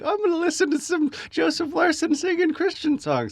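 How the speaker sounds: very high, put-on mock voice; naive fan. Comic mimics searcher clicking preacher's video, naive. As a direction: imitate an innocent listener in a silly high pitch.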